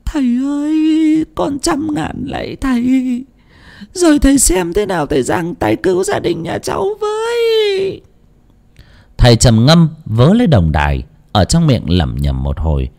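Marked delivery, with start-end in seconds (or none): 0.0-8.0 desperate woman crying to the fortune teller to save her family; crying plea. A narrator's reading. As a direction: beg through tears. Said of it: "nước mắt ngắn nước mắt dài".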